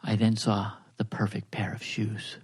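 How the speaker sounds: slow, low whispered narration; seductive whisper. Acted. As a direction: whisper the story, drawn out and intimate. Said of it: "Dipsy voice model to turn you off".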